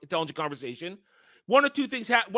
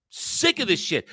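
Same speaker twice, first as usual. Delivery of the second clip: usual speaking voice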